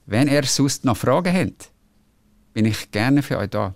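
Wenn er sonst noch Fragen hat said in Swiss German, hat as hent. Shown as a demonstration. Host reading the listener's sign-off, in Swiss German. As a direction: read out in Swiss dialect sounds. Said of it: "das klingt aber gut, wie du das sagst".

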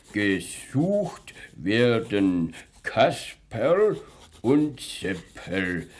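spelling it out slowly while writing; each word drawn out as he writes; slow dictation. Acted. Policeman writes out a notice.

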